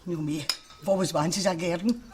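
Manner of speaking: ordinary talk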